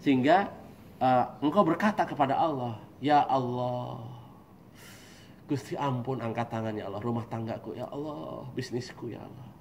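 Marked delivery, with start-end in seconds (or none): 3.0-9.5 slow, fading, broken plea; a servant crying out to God in hardship; desperate prayer. Retold or imitated. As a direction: pray as if at the end of your strength. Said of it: "Sampai menjerit".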